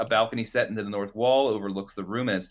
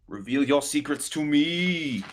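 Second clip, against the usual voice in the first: slow, raised, hammed-up command; mock incantation; 6 semitones higher, slower than usual. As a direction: intone it like a would-be sorcerer. Mock command to unreadable letters.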